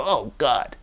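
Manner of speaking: low, soft, weary oh God; exasperated groan. Retold or imitated. Wife groaning at husband, low and soft exasperation. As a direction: sigh it out, fed up. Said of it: "And she'd go".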